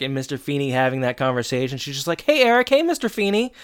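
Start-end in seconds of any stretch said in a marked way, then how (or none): 2.2-3.5 high, louder mock hello; breezy greeting. Girl walks by Eric and Feeney, breezy high greeting. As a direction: imitate a girl calling hello in passing.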